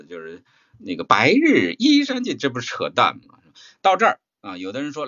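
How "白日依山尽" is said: slow, loud parody of poetry reciting, pitch heaved up and down; mock stiff recitation. Teacher mocking students' stiff poem recitation, exaggerated and slow. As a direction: overdo the swells like a bad reciter. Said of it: "我还讽刺我有人在那肩周炎似的朗诵".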